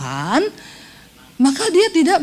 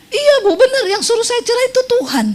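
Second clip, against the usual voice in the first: mock insistence; 9 semitones higher than usual. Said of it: "Saya bilang".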